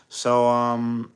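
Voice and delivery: ordinary delivery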